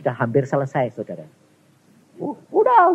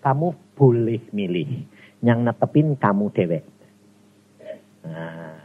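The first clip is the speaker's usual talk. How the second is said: ordinary talk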